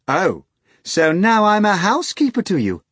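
high, loud offended retort; indignant cry. A narrator's reading. Natasha scolds the hog, high offended cry. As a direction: bristle at the insult. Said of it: "Natasha cried".

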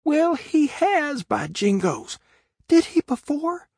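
very high, gleeful astonishment; joyful relief. A narrator's reading. Huckleberry seeing the dog's back to them, high and joyful. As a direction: squeal it with delighted surprise. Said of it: "looked with joy in his heart".

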